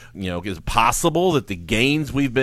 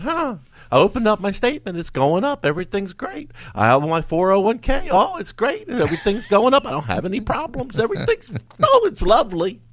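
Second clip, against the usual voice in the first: carefree investor voice; 7 semitones higher, faster than usual. Retold or imitated. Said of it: "out there right now thinking".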